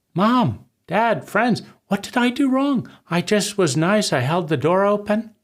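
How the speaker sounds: put-on high, pleading little child's voice, rising to a near-cry on "what did I do wrong"; a hurt child begging Mom and Dad to tell what they did wrong; desperate child. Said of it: "asking desperately".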